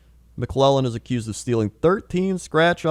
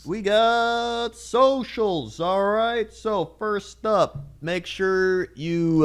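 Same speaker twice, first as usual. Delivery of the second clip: slow hype announcer; 6 semitones higher, 4 dB quieter, much slower than usual. Pretend. Hyping the socials outro.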